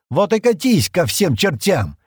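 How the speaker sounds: loud, high, drawn-out angry bark; barked angrily. A narrator's reading. Стэн sending Джемма off with the antigrav, barking. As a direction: bark it out, stretching each word hard. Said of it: "рявкнул Стэн".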